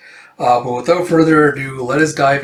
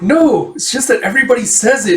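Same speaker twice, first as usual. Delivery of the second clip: high, loud, flustered denial; flustered, embarrassed; 9 semitones higher, 4 dB louder than usual. A narrator's reading. Paria denying to Amir that she's cheeky, flustered. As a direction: protest in embarrassment, pitched well up. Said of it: "getting all flustered and embarrassed".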